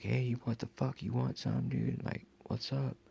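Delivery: confronting. Retold or imitated. Confronting followers on the street.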